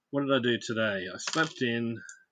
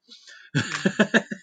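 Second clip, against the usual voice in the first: burst of laughter; 7 semitones higher, 7 dB louder than usual. Real, not acted. Laughing at Twitch joke.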